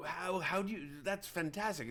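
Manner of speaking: high-pitched, marvelling hermit; amazed old druid in a put-on high voice; Prattis. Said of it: "I don't remember his accent".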